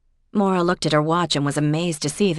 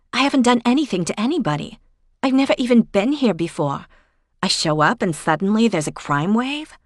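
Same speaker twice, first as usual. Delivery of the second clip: rising, fast, exasperated; high, quick, indignant protest; frustrated outburst; 6 semitones higher, 3 dB louder than usual. Acted. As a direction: pour out the frustration, pitched up.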